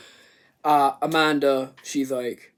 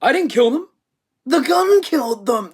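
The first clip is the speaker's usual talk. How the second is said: higher, louder hammed-up villain; loud mock killer logic; mock villain excuse; 9 semitones higher, 8 dB louder than usual. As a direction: smugly declare the gun did it. Mock Jigsaw logic quote.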